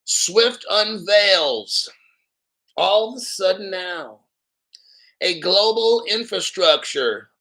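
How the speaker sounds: very slow, weighted reading of "swift unveils"; dramatic read-out; emphatic headline reading. Shown as a demonstration. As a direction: lean hard on every word of the headline. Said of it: "I show you this article here".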